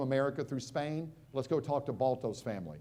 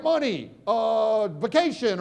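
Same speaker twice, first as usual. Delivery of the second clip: slow, dragged-out guessing; hammed-up clueless guesser, stretched words; mock American audience; 7 semitones higher, 6 dB louder, slower than usual. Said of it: "They're like".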